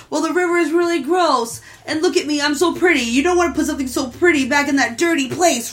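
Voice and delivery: high-pitched, playful pleading voice; vain merman pleading. Retold or imitated. Merman talking his way into staying, playfully vain and pleading.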